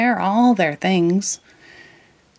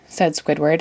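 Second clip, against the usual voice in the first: ordinary delivery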